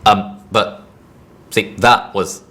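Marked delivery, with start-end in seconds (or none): none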